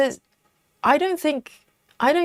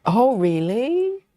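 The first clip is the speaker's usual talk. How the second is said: surprised; 2 semitones higher, much slower than usual. Real, not acted. Reacting to comment, surprised.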